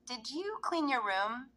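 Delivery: mother quizzing her daughter about her room; parent asking. Retold or imitated. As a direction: ask slowly, sounding like you already suspect. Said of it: "So I might say to my daughter".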